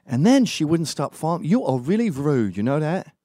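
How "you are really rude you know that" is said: lightly mimicked indignant woman, pitched up; British woman's complaint. Woman following him scolds him, lightly mimicked indignation. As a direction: imitate her scolding, lilting up. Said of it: "wasn't that working class".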